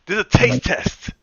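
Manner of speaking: high, loud insisting excuse; mock indignant protest. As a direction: plead your case loudly to the wife. Acted excuse to screaming wife.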